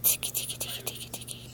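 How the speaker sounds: soft unvoiced tickle; whispered tickle. Real, not acted. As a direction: breathe it, barely audible. Tickling the baby.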